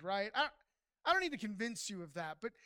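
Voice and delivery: normal talking voice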